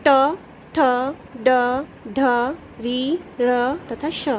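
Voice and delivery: slow, separate retroflex sounds; each sound set apart, ending slow; letters said clearly. Shown as a demonstration. Teacher to children, demonstrating retroflex letters slowly and clearly. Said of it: "बोल के देखिए".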